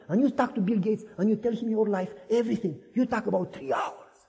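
usual speaking voice

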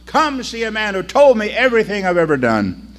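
calling out loud to the street; shouting. Acted. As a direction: call the whole town to come. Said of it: "she shouted out".

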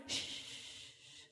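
a hushed unvoiced shh; shushing. Parents hush their daughter in church, whispered shh. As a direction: hush the child sharply under your breath. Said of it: "My parents".

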